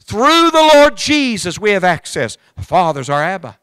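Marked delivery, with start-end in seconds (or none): 0.0-2.4 high, loud, slow preaching cry; ringing revival sermon voice; full-force preaching. Acted. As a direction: lift the words out over the congregation, slowly. Preacher exhorting the congregation.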